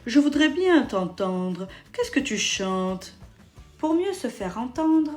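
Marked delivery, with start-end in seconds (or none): 0.1-3.1 put-on fox feigning old. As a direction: pretend to be a frail deaf old man. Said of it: "Je suis vieux, je suis sourd".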